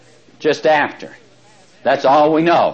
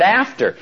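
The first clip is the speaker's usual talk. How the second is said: "after" lifted high and held for emphasis; teacher stressing "after" to the class; 5 semitones higher than usual. Shown as a demonstration. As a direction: lean hard on the word "after". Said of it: "'cause I'm emphasizing the word".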